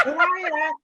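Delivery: high-pitched mock hello to an acquaintance; tipsy cheery greeting. Clubber greeting mum's mate on her way to work, high mock-cheery. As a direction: sing out an over-friendly hiya, acted.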